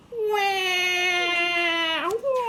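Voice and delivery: put-on high wailing baby cry rising; baby crying wah. A narrator's reading. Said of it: "The baby cried even louder".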